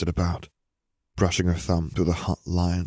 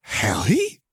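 higher, rising astonished question; gaping disbelief; 4 semitones higher than usual. A narrator's reading. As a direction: ask it as if seeing a ghost. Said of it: "she gaped".

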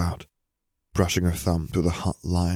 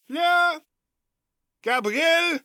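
very high, calling from afar; ringing call; slower than usual. A narrator's reading. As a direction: call their names up the stairs. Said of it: "A female voice rang up the stairs".

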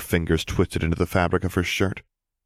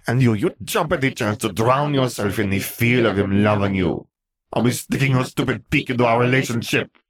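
high, heated tirade; jealous rant, pitched up and heated; angry accusation; 6 semitones higher than usual. A narrator's reading. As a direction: lash out at your sister, voice raised. Fleur turns on Gabby, quarrel.

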